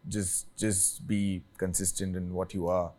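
ordinary talk, nothing marked

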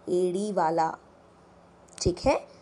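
normal talking voice

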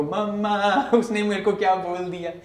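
lightly acted child running to complain; tattling child. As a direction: go up high, half laughing, as a tattletale. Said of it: "like we would go".

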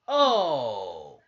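sudden loud gasp at a hard slam; excited exclamation. Real, not acted. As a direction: shout it as the wrestler crashes down. Big move in the match.

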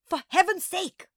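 loud, high burst of impatience; exasperated outburst. A narrator's reading. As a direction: explode at the wailing child. Exasperated adult at wailing child.

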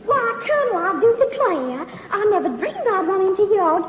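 flirt in an exaggerated Southern belle accent; syrupy Southern charm turned on to win the berth; put-on southern belle. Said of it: "my little old southern routine".

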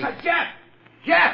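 high urgent call. Acted. Porky calls Jeff over from outside, high urgent call. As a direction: call him from across the yard.

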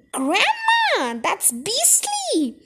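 put-on high, drawn-out boyish outrage; disgusted, long-stretched objection; appalled protest. A narrator's reading. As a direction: wail it slowly, pitched well up. Boy revolted by beetle eating.

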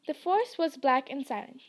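plain reading voice

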